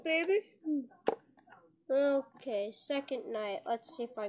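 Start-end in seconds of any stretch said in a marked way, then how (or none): 0.0-0.4 loud victory shout; excited cheer. Real, not acted. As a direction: whoop of triumph. Celebrating winning the night.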